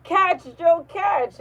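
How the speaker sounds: high, urgent call to catch. Retold or imitated. Pretend throwing a box at Joe, calling out. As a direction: re-enact a loud, high call to a friend.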